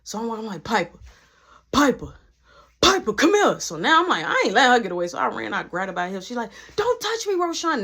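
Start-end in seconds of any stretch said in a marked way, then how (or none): 0.6-3.6 calling after her. Retold or imitated. Boyfriend calls after Piper as she walks off, increasingly loud. 6.8-7.8 high-pitched, imitated indignant outburst; retold loud objection. Piper pulls away from her boyfriend, high indignant protest. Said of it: "Now we're loud".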